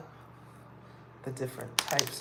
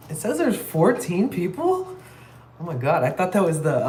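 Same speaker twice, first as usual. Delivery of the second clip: real surprise; 6 semitones higher, 7 dB louder than usual. Real, not acted. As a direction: gasp it out at the viewer count. Sees viewer count, shocked.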